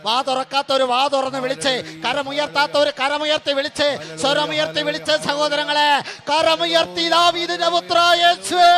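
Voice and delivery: high, loud fiery preacher's call; preaching at full force. Acted. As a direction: whip the crowd into shouting praise. Preacher urges loud praise.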